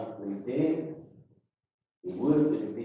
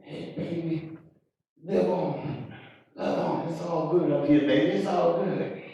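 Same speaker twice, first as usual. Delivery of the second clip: higher, louder call from above; a departed uncle calling down to comfort; loved one from heaven; 6 semitones higher, 6 dB louder than usual. Acted. Uncle in heaven calling down to the child, higher and louder.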